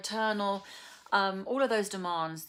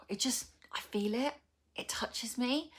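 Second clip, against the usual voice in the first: a touched, breathy hush; emotional whisper; 6 semitones higher than usual. Real, not acted. Vlogger admits the poems move her, whispered. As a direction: whisper it as if the feeling catches you. Moved, talking about the poems.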